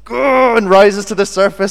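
a sudden high, loud battle cry; high loud mock war cry; mock rallying cry. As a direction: let the Celtic side roar out. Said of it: "half Scottish side of me kind of goes".

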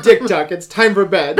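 laughing parent call, loud; calling kids to bed. Retold or imitated.

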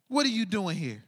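normal talking voice

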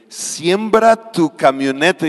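slow, commanding divine voice; lightly voiced slow command from above; voice of God. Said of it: "el Espíritu Santo me habla y dice". (voz de Dios lenta y solemne)

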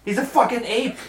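high, loud outburst; exasperated shout. Real, not acted. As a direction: protest at the top of the voice. Player protests loudly.

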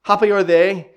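normal talking voice

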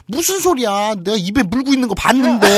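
high, loud indignant protest; hammed-up "I saw it myself"; overacted insistence. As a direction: exaggerate wildly to convince. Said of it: "그러니까 막 모발합니다".

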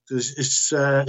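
normal talking voice

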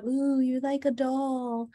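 a hint of kids' sing-song admiration; cooing kids. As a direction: mimic children fussing over a doll, slowly. Kids cooing over her hair.

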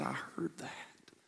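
slow, hushed whisper. Acted. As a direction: let it fall softly. Jesus answers quietly.

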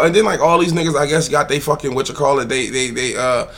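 usual speaking voice

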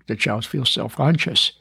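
usual speaking voice

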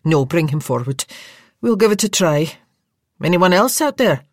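Scottish nurse ordering the bearers; Scottish orders. Acted. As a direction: take charge in a Scottish voice. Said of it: "a Scottish voice".

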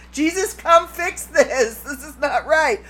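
loud, slow exasperated plea; drawn-out call on Jesus as if the TikTok guy; imitating Kevin Lawson's catchphrase. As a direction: mock-desperate appeal, laughing at the end. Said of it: "It's his".

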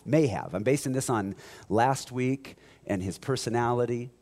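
ordinary delivery

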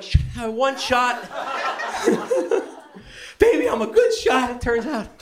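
very high, laughing brag; high, giddy boast with laughter; proud. Retold or imitated. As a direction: show off to the wife. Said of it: "And I'm like".

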